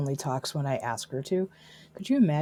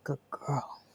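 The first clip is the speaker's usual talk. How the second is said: softer, tender repeat of praise; 9 dB quieter than usual. Real, not acted. Owner softly praising her dog Cora. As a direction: murmur it gently to the dog.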